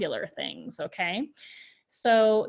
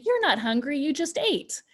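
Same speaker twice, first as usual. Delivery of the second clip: higher-pitched, brushing-off contradiction; dismissive parent; 5 semitones higher than usual. Acted. Parent answering a hungry child, dismissive. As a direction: brush the child's complaint aside. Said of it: "this is dismissing their feelings".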